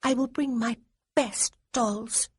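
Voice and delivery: put-on high, solemn child's promise; a girl deadly serious about dolls; grave little girl. A narrator's reading. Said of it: "she said with complete gravity".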